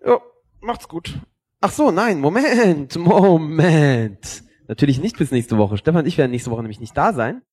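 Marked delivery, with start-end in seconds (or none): 1.6-4.4 high interjection, last word drawn out; calling a halt. Real, not acted. Host halts the sign-off to correct it, calling a halt. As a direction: break in high and stretch the final word.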